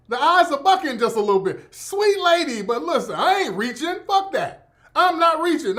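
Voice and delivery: high-pitched, heated comic insistence; loud, defensive protest. Real, not acted. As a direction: protest loudly, cutting off the objections. Insists again he is not reaching.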